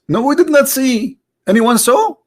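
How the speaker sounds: very high-pitched baffled answer; mock innocent reply. Comedian answering the verse for the audience, mock innocent. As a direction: answer way up high, playing clueless.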